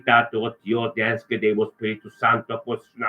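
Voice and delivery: usual speaking voice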